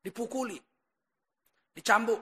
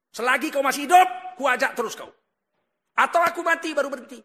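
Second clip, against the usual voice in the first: acted defiant insistence; 4 semitones higher, 7 dB louder than usual. Acted friend insisting in quarrel.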